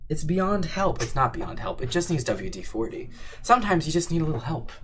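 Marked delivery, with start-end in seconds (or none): 0.1-1.0 high-pitched playful lament; mock despair. Streamer gives up on the chair, high mock despair.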